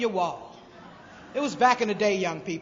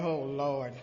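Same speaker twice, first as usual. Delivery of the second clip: weary father; 8 semitones lower, 4 dB quieter than usual. Retold or imitated. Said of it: "My father was like".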